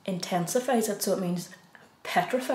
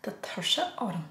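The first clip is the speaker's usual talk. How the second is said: softer, whispered "Tá tuirse orm" with no voice; tired whisper; 4 dB quieter than usual. Shown as a demonstration. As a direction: breathe "Tá tuirse orm" out as if worn out. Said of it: "just remember the emotions be on you".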